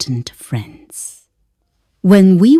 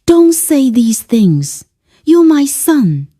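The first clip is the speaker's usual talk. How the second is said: higher, earnest plea; pleading; 8 semitones higher, 3 dB louder than usual. A narrator's reading. Mother begging her son to stop, pleading. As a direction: implore him, pitch climbing. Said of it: "I pleaded softly".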